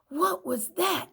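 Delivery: high startled yell. A narrator's reading. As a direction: jump and shout it. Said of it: "yelled Stapler".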